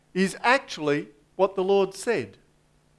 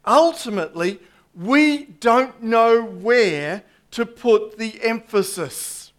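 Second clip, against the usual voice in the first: ordinary talk, nothing marked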